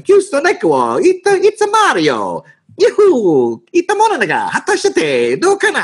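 very high, cartoonish Italian-accented Japanese; weird Italian-sounding accent. Actor's first botched take of the Japanese line, exaggerated Italian accent. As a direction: butcher the Japanese with a sing-song Italian lilt. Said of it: "I was doing this weird accent".

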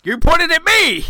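loud, high playful outcry; excited shout. Real, not acted. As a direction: shout it out, half laughing. Caught being pointed at on camera.

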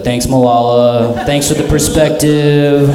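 slow, drawn-out fake thanks; mock grateful. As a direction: thank her with dripping irony. Sarcastic reply to Malala.

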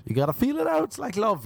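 high-pitched playful aside; squeaky aside. Real, not acted. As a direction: toss it off in a squeak. Pitch jump on repeat.

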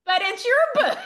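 high, loud mock disbelief; hammed-up incredulous protest; incredulous husband. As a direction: exclaim it as a baffled spouse, close to laughing. Husband's disbelief, retold laughingly.

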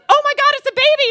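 high, loud mother's shriek; stunned exclamation. Retold or imitated. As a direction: cry out in shock at the newborn. Parents shocked at newborn.

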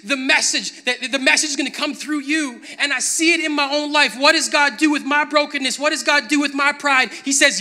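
loud, rising sermon, building as it goes; full-force preaching. Acted.

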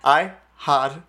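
drawn-out British "had"; teasing British-accent imitation; imitated British accent. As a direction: mimic a British vowel in "had", slowly. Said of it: "with a British accent".

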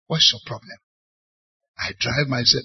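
ordinary delivery

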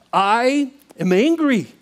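loud, drawn-out angry confession; retold angry outburst.

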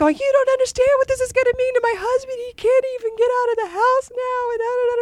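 very high, rapid tearful wail; mock sobbing. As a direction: imitate someone blubbering through the words. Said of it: "And she starts sobbing".

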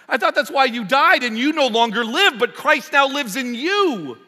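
loud, high, indignant mock sarcasm; changed tone. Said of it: "Our tone changes".

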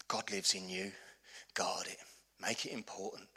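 hushed, intense whisper; whispered urging. Acted. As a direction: lean in and whisper it. Preacher drops to urgent hush.

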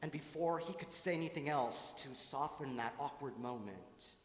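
usual speaking voice

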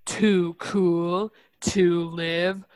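slow, rough sick-sounding voice; fake sick voice. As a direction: read it like you have bronchitis. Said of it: "I have to actually try to read it in that voice this time".

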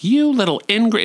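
high, mock-indignant outburst; Dawn Davenport. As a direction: imitate a camp diva scolding. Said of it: "but I'm Dawn Davenport".

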